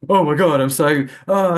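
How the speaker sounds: imitating Jon Snow high. Podcast guest mocks a TV presenter's bad trip, loud panicky impression. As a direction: ham up a freaked-out man on a high. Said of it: "he basically goes".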